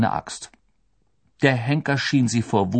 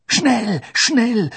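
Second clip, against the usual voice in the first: high, loud urging; strained, high cry to hurry; urgent moan; 13 semitones higher, 6 dB louder than usual. A narrator's reading. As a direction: groan it out loudly in haste. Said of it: "stöhnte Hermine".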